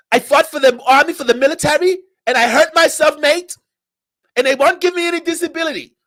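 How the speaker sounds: high-pitched mock Australian accent. Australian complaining about his government, high-pitched mocking Australian accent. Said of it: "had the nerve to be like".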